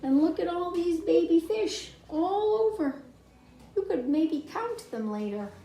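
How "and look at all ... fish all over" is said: usual speaking voice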